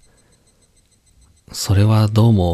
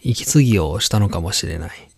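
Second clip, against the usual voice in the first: plain reading voice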